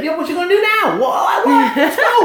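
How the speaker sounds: high, cocky playground challenge; little kid trash-talk. Retold or imitated. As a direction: taunt with big brother standing behind. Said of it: "now he can talk all that trash".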